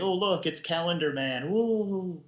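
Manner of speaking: high, goofy announcing voice; mock cheerful villain. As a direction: ham up a cheesy villain reveal.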